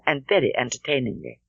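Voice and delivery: plain reading voice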